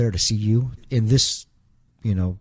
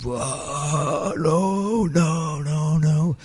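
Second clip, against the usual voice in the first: imitated wail; 7 semitones higher, much slower than usual. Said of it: "he was yelling and screaming".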